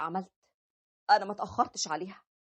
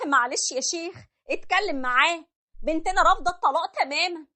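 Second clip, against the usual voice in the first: appealing mother; 8 semitones higher than usual. Acted. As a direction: press the sheikh to talk sense into him.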